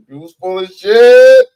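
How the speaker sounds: loud, angry shout on shit; shouted insult. Real, not acted. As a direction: yell it right in their face.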